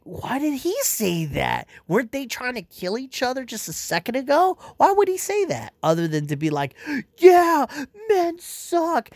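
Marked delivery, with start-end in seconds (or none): 0.0-5.7 high, incredulous questioning; baffled outrage. Retold or imitated. Fan baffled by Green Lantern's line, rising high disbelief. Retelling own baffled reaction. 7.2-9.1 high, loud mocking sneer; sarcastic mockery. Mocking the movie's message about men, high sarcastic sneer.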